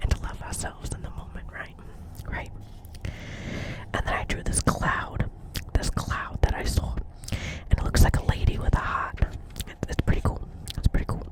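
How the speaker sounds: ordinary delivery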